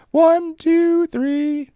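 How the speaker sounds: put-on very high little-girl count. Daughter counting push-ups for the Ranger students, high-pitched. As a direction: call the cadence like a bossy child. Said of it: "little girl high-pitched voice".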